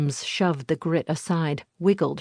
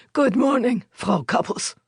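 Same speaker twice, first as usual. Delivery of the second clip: gruff muffled voice; 5 semitones higher than usual. A narrator's reading. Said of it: "said the voice".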